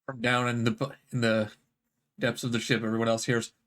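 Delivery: low ominous narration; low dramatic voice. Acted. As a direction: drop into a deep spooky tone.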